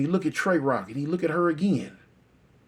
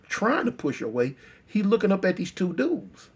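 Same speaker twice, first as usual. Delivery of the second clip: ordinary delivery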